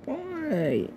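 despairing, high whispery "Why?"; hushed anguished why. Real, not acted. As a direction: let "Why?" fall away nearly to nothing, still high and pained. Upset over fetish videos of him.

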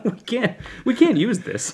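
laughing while talking; words squeezed out through laughter. Real, not acted. Laughing at cold open.

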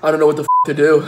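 usual speaking voice

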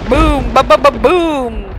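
loud high thunder boom. A narrator's reading. Thunder sound read aloud.

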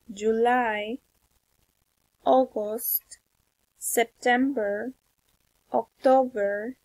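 months one by one, rising tune; spaced-out classroom list intonation; repeat-after-me drill. Shown as a demonstration. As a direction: model each month, pause for the learner.

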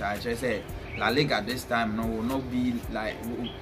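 ordinary talk, nothing marked

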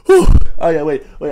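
normal talking voice

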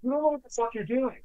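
high, sneering imitation of the caller; mock angry caller. Owner retelling the caller's insult in a high mocking voice. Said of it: "He goes".

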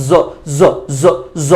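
জ as a voiced buzzing z, repeated; buzzing z sound. Shown as a demonstration. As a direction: buzz the জ like z. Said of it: "জ এর মতো করে উচ্চারণ করব". (কাঁপানো জ)

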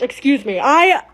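ordinary talk

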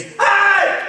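high, loud shout of hey; emphatic hey. Shown as a demonstration. Preacher showing what behold means, shouting. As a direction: shout hey to grab attention. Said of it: "That's an emphatic word".

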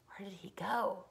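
soft, secretive whisper; hushed whisper. A narrator's reading. Wondering where the vanished boy went, whispering. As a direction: whisper it like a mystery.

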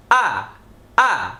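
"আ" said short with the mouth wide open; open-mouthed vowel. Shown as a demonstration. Teacher modelling zabar for learners, "আ" short and open-mouthed. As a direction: drop the jaw for a brief, open "আ". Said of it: "মুখটা খোলা থাকবে".

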